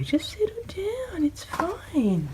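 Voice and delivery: slow, high whisper; soothing whisper. Real, not acted. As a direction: whisper them calm. Soothing animals.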